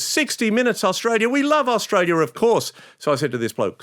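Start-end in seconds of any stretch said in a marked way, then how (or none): 0.0-2.7 gushing politician. Retold or imitated. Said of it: "Suddenly she says".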